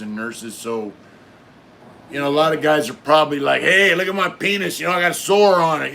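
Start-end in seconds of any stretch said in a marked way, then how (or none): 3.6-5.9 loud, high, hurried imitation of inmates; mock eager inmate. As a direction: call out pushily, mocking the attention-seekers. Mimics inmates pestering female medical staff.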